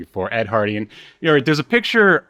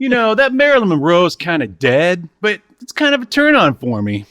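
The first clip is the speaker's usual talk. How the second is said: higher, lightly hammed-up voice of a smitten rock star; mock his dopey crush, pitched up; 7 semitones higher than usual. Co-host mocking [name]'s pickup lines to partner, higher hammed-up voice.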